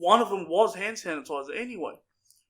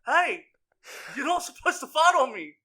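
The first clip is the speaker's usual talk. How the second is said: louder, higher mock scold; retold scolding; 7 semitones higher, 6 dB louder than usual. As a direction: tell him off, loud and high.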